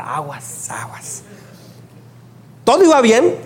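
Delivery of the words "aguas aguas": whispered, teasing watch out; a hushed tease that the preacher is here; mock warning whisper. Friends mock-warning about the preacher.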